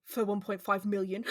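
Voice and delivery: badly faked British voice, played for laughs; mock British, knowingly awful; horrible British accent. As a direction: repeat a hammy British accent. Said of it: "horrible British accent".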